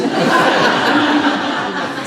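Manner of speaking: loud breathy burst of laughter; real laugh. Real, not acted. Teacher laughing at the birds' excuses, loud breathy laugh.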